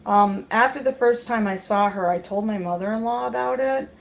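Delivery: usual speaking voice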